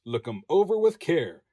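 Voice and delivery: ordinary delivery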